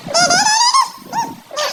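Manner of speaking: mock scandalised squeal; high shrieking call. Mocking shriek at the girl.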